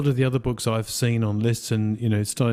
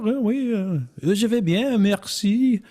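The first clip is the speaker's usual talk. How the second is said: playful put-on French accent, pitched higher; mock French accent; 9 semitones higher than usual. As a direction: ham up a French speaker answering in French. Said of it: "we should talk like we from Louisiana".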